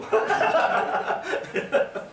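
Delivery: high, open laugh; amused high-pitched laughter. Real, not acted. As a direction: burst out laughing for a couple of seconds. Amused at audience's guess.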